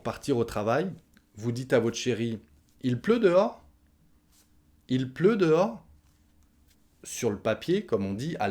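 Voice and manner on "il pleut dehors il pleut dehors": "Il pleut dehors?" lifted high as a question; rising question tune. Shown as a demonstration. Asking the partner before leaving for work, rising question. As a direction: raise the pitch of "Il pleut dehors?" into a query. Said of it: "on va monter le ton à la fin".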